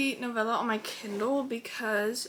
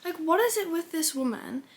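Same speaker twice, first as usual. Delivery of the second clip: half-joking outrage, louder; indignant outburst; 6 semitones higher, 5 dB louder than usual. Real, not acted. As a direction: scold the author in disbelief. Outraged at book ending.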